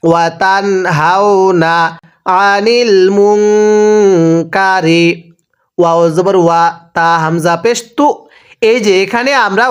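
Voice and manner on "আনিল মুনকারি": আনিল মুনকারি drawn very slowly with the nun held nasal; nasal gunnah. Shown as a demonstration. Teacher shows gunnah to students, slow nasal recitation. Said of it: "একসাথে আওয়াজ দিয়ে পড়তে হবে".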